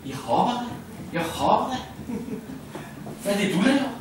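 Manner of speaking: put-on high bright little frog child. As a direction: answer proudly like a small child. (høj barnestemme)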